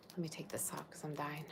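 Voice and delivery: unvoiced whisper, half to herself; a murmured whisper while fussing with clothes; whispered aside. Real, not acted. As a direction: breathe it out under her breath.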